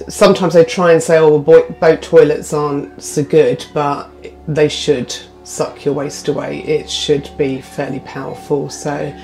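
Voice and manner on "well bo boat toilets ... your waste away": ordinary talk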